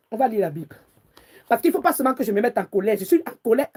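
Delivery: ordinary delivery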